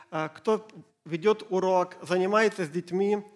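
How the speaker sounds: ordinary delivery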